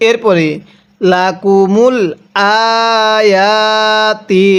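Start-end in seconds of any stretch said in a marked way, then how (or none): 1.1-4.5 "আয়াতি" very slow, with both the hamza and the ya drawn out; double stretch. Shown as a demonstration. Teacher shows two stretches in one word. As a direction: hold both vowels in "আয়াতি". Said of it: "এই হামজায়ের মধ্যেও টান দিব".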